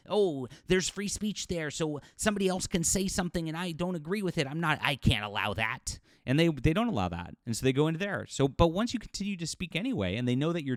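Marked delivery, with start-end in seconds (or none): 0.1-6.0 higher-pitched imitation of the easily offended; mock indignation. Mimicking people who fled Twitter over free speech, mock-indignant. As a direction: put on a fussy, aghast objection.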